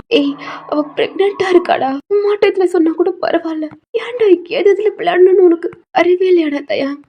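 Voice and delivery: mother telling off her son; angry scolding. Acted. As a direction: scold with building outrage.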